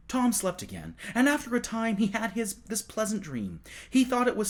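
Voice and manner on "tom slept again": ordinary delivery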